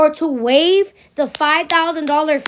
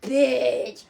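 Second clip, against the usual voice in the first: mock greetings; much slower than usual. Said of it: "Bitch could be like".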